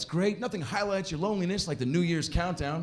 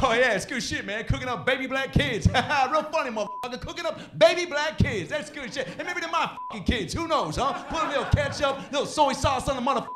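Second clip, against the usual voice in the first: imitating angry heckler; 10 semitones higher than usual. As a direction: sneer it sarcastically, pitched up. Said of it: "He was like".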